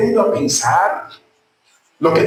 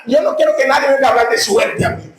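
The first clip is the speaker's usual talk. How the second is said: preaching shout; 7 semitones higher, faster than usual. Real, not acted. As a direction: proclaim it with full force. Preacher declares faith loudly.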